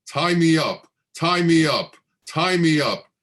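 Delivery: put-on a girl yelling at the top of her lungs; shrill screaming. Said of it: "starts screaming at the top of her lungs in a shrill, piercing voice".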